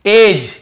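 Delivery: एज said loud as edge with no d; clear model word. Shown as a demonstration. Teacher calling out एज for the class. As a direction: announce एज clearly, d silent. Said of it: "एज, ये पढ़ रहे हैं".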